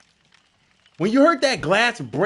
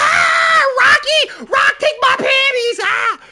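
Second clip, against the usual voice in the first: much higher, louder fangirl shriek; screaming fan; 7 dB louder, slower than usual. Retold or imitated. Crowd fan screaming at the Rock for attention, shrieking. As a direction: squeal for the Rock. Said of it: "Everyone's like".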